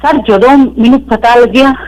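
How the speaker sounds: normal talking voice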